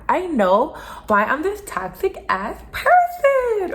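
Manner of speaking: joking self-mockery. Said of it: "I'm kidding".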